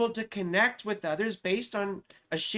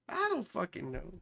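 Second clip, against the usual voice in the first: usual speaking voice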